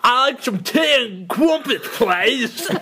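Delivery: high, slow hammed-up English accent; badly faked English, drawn out. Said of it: "now do an English accent".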